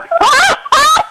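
a high burst of real laughter. Real, not acted. Amused caller on radio.

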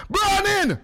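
a lifted cheerful hail; upbeat radio hello; bright high greeting. Real, not acted. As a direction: sing out the morning high. Host greets callers, pitched high.

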